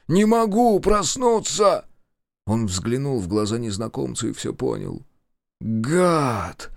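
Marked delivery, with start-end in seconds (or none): 0.1-1.8 high, loud, drawn-out cry; slow, strained panic; rasped desperation. A narrator's reading. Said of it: "прохрипел спящий". 5.6-6.7 drawn-out curse; lightly acted outcry; understated yell. A narrator's reading. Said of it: "услышал он свой собственный вопль".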